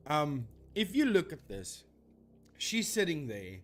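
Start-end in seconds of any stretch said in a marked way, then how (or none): none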